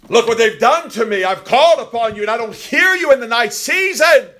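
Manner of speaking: very high, loud preaching shout; shouted plea. Acted. Preacher voicing Christ's complaint to his Father, shouted plea. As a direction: cry out the complaint at full force.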